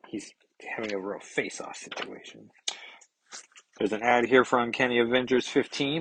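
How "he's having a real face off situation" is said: soft whispered punchline; joking whisper. Real, not acted. Reader cracks a pun to his viewers, whispered. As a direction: breathe the pun under your voice. Joking aside while reading comic.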